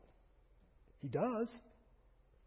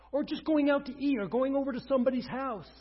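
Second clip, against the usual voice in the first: louder, higher preaching push; full-force preaching; 9 semitones higher, 10 dB louder than usual. Acted. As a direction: drive it home to the congregation.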